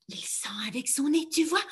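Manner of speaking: secretive whisper. Shown as a demonstration. Teacher narrating the sniffing fox, whisper.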